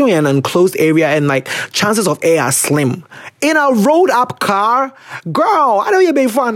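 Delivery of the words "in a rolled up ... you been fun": high-pitched mock outrage; incredulous scolding. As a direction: scold a friend in high, exaggerated disbelief. Acted scolding of a car passenger.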